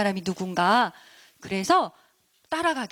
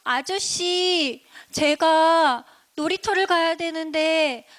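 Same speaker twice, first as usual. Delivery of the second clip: put-on high, slow, drawn-out child voice; higher-pitched kid addressing a grown-up; little kid; 5 semitones higher, slower than usual. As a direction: stretch each phrase like a small child asking. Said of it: "네, 꼬마 친구가".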